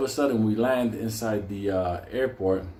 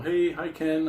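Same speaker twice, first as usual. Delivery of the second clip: breezy passer-by imitation; 6 semitones higher, slower than usual. Mimics untroubled blonde passers-by.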